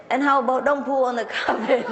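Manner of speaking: high, giggling retort; plumber, laughing. Retold or imitated. Plumber challenging the owner, laughing. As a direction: tease while laughing. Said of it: "So the plumber said".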